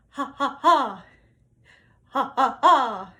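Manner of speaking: breath-support ha drills; punched ha. Shown as a demonstration. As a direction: kick each ha from the belly. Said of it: "repeat after me".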